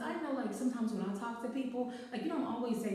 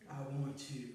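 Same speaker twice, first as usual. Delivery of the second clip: much lower and much softer, a hushed, breathy-sounding line; low seductive come-on; 8 semitones lower, 5 dB quieter than usual. Retold or imitated. The guy leaning in to tell his date, low hushed come-on. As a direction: purr it low like a smooth-talking date. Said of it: "not one time did he say your name".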